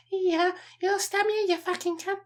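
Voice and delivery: jokey Liverpudlian accent impression pushed high; bad Liverpudlian accent. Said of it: "the worst Liverpudlian accent I've ever done".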